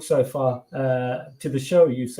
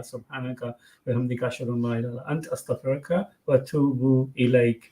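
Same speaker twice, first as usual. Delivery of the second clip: quick, softer murmured recitation of the closing dua; closing prayer; 2 semitones lower, 4 dB quieter, faster than usual. Real, not acted. Host closing the show for brothers and sisters, fast soft prayer. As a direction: rattle off the familiar supplication.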